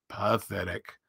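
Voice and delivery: low, soft gloomy donkey; gloomy Eeyore. A narrator's reading. Gray old donkey looks at himself in the water, gloomy. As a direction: sigh it out as a dejected, low-voiced donkey. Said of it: "said Eeyore gloomily".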